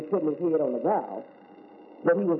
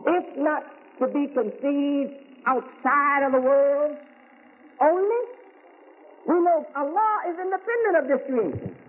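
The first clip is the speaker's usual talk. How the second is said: higher, raised preaching voice; 7 semitones higher than usual. Acted. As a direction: lift it up and preach to the congregation. Preaching on God's oneness.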